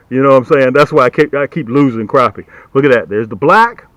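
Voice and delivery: normal talking voice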